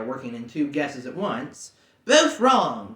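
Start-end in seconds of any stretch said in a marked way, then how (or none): 2.0-3.0 louder, relieved shout; cried out. Acted. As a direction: call it out in triumph. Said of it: "cried Bilbo".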